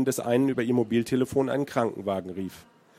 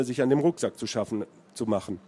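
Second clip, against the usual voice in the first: ordinary delivery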